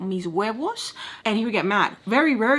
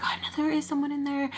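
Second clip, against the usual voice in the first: higher mock girl's voice; imitating giggling girls; 7 semitones higher than usual. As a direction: lightly mimic a teasing girl, pitched up. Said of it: "I can hear girls giggling".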